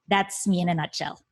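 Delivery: ordinary delivery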